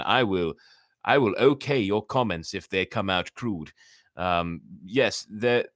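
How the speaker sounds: plain reading voice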